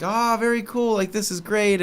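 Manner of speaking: mock-impressed customer chatter; impressed newcomer. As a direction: play an easily pleased customer. Older newcomer chatting to hostess.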